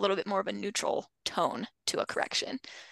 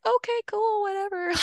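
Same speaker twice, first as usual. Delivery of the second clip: much higher, breezy don't-care voice; unbothered dog; 11 semitones higher than usual. Retold or imitated. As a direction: play the dog shrugging it off, high and casual.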